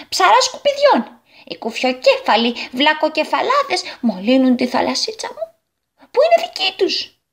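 high, indignant outburst building to a cry; angry shouting. Acted. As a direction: rage in a high character voice. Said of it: "άρχισε να φωνάζει". (θυμωμένες φωνές)

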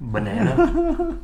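high, slow disbelieving echo; incredulous. Real, not acted. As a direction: ask it back in disbelief, laughing.

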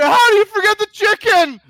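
high, loud anguished scream. Pretend. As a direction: wake up screaming it in a panic.

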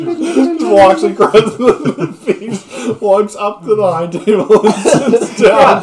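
high, loud, slowed by laughing; helpless laughter. Real, not acted. Game master describes latecomer crossing the feast, laughing helplessly. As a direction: squeeze the words out between laughs.

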